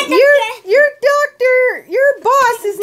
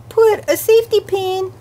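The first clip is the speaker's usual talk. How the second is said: ordinary delivery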